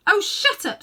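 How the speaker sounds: high, loud miserable outburst; miserable snap. A narrator's reading. Diggory snapping at the witch, high miserable outburst. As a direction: lash out in misery. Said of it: "still in the same voice".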